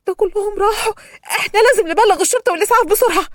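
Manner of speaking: high, tearful panic; panicked crying. A narrator's reading. Survivor sees everyone died in the crash, crying panic. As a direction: cry out in horror that all are dead. Shocked at burned van.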